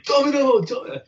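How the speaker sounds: imitating a fan's high-pitched yell. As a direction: cry it out like a relentless heckler. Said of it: "just yelling".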